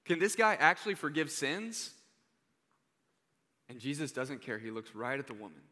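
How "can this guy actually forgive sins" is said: raised, louder disbelieving question; incredulous crowd. Retold or imitated. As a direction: voice the doubting crowd, louder and higher. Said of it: "Everyone's like".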